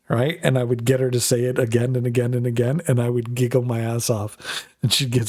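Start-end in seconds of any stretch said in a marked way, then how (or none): none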